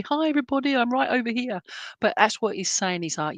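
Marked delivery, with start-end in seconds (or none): 0.0-1.6 high, sing-out greeting; calling from afar. Acted. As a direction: hail everyone from further back, pitched up. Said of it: "if I sat back, it'll be".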